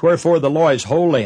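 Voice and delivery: ordinary talk, nothing marked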